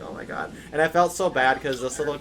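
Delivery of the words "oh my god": whispered under the breath; whispered dismay. Retold or imitated. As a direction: whisper it in quiet shock. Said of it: "I'm just like".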